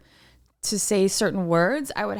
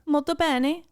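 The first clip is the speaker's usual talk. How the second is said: Italian phrase; 7 semitones higher than usual. Acted. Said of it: "what's that mean?".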